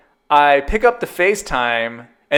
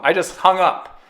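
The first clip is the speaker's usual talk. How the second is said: ordinary talk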